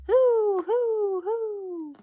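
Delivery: high, loud owl hooting; long high hoots, fading in volume. A narrator's reading. Owl answering the animals from its hole, high hooting. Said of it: "an owl says".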